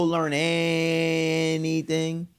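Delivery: ANYTHING with a long, high held first syllable; drawn-out emphasis. Real, not acted. Tells listeners culture can't just be learned, drawn-out stress on "anything". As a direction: stretch the first vowel of "anything" way out. Mocking emphasis, stretched word.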